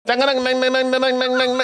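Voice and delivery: sung high "dang dang dang" news theme; news jingle imitation. Shown as a demonstration. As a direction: hum the news intro as dang dangs. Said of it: "That's a newsreader".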